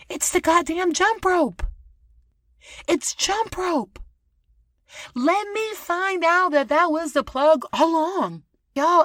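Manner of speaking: high-pitched, loud, excited burst; excited realization. Real, not acted. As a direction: announce the discovery like breaking news. Excited jump rope discovery.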